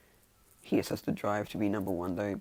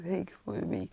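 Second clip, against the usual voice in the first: high, light call; calling others over; 11 semitones higher than usual. Acted. She goes to everyone to follow her, calling out. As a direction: beckon in a raised pitch. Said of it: "she just calls and".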